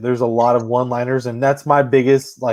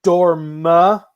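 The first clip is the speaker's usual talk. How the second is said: mock clever comeback; 3 semitones higher, 5 dB louder, much slower than usual. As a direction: toss out mom's comeback, drawn out. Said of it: "trying to make a clever comeback".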